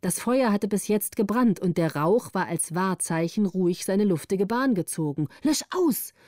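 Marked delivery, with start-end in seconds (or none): 5.4-6.1 sharp, much higher call to put the fire out; urgent call. A narrator's reading. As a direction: call it out urgently, pitched well up. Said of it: "rief Gritta".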